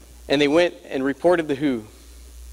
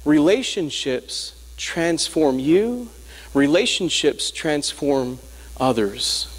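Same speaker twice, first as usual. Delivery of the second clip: slow emphasis; much slower than usual. Acted. Slow emphatic sermon point.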